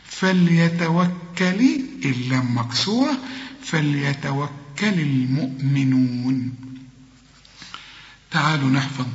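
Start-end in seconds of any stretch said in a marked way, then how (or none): 0.1-1.8 فليتوكل very slow, every letter light; all thin. Shown as a demonstration. Teacher drawing out فليتوكل letter by letter. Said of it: "كل الكلمة مرققة". 3.6-6.5 فليتوكل المؤمنون slow and light throughout; all thin. Shown as a demonstration. Teacher closing the verse for the student. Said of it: "كل الكلمة مرققة".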